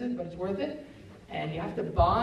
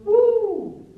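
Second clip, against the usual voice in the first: loud, high, drawn-out "woo"; long high woo; overwhelmed whoop; 14 semitones higher, 12 dB louder than usual. Acted. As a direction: whoop as if it is all too much. Exclaims at piled-up troubles.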